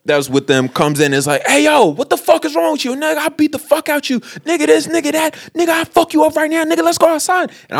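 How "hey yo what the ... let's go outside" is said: high-pitched rant without much added volume; mock threats. Friend threatens the large man, mock furious. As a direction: imitate a worked-up challenger, high throughout. Other dude threatening a fight, retold.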